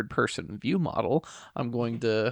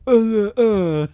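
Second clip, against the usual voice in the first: high, loud burst of laughter; laughing; 11 semitones higher, 12 dB louder than usual. Real, not acted. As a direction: crack up out loud. Laughing at the simpleton joke.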